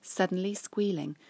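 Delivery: usual speaking voice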